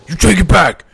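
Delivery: loud, high yelling; furious bully. Acted. GM as the bully yelling at the elf boy, furious. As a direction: shout it down at the smaller boy.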